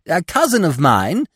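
high, loud eager squirrel; jumping in with high-pitched eagerness. A narrator's reading. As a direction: pipe up eagerly, bursting to tell. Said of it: "he began eagerly".

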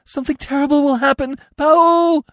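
very high, loud deer wail; shrill, hysterical animal warning; panicked bleating cry. A narrator's reading. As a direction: bleat in terror, piercingly high. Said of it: "cried Feline".

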